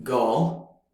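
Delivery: put-on girl with no r, British. Shown as a demonstration. Teacher showing non-rhotic British girl, British accent. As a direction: say girl like a Brit, no r.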